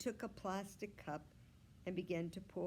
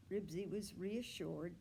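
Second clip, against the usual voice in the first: plain reading voice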